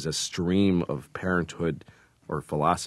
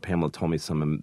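ordinary talk, nothing marked

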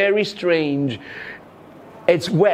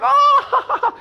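put-on kids laughing; 3 dB louder than usual.

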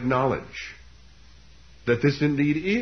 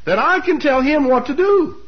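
high-pitched, incredulous mock questioning; acted-out sarcastic challenge, pitched high; sarcastic disbelief; 9 semitones higher than usual. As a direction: scoff in exaggerated disbelief. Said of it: "The sarcasm is very implicit".

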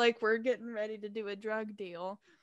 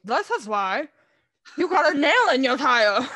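louder muffled retort through gauze; gauze in mouth; 10 dB louder than usual. Retold or imitated. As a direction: snap it through a mouthful of cotton. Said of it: "I've caught my mouth".

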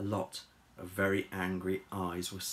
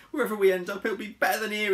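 loud, raised, hurried voice; flustered relief; 11 semitones higher, 7 dB louder, faster than usual. Acted. Harold spinning away from danger, loud and flustered. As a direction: call it out while fleeing.

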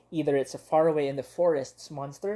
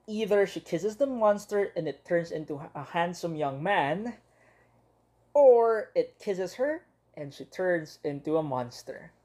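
ordinary delivery

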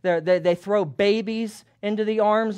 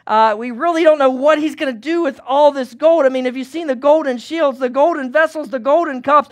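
higher, louder, breathless townsfolk chatter; excited gossip; 5 semitones higher, 6 dB louder than usual. Acted. A townsman chimes in about the king's gold, high and loud. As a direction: marvel at all the gold. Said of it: "Someone else chimes in".

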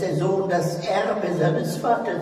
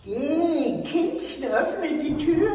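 high frail urging; 11 semitones higher than usual. Acted. Old lady sends girl away.

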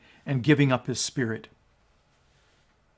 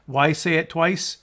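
normal talking voice

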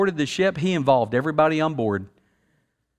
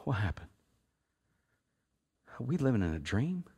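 stunned hush; 5 semitones lower, 12 dB quieter than usual. Retold or imitated. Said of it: "they just wanna go".

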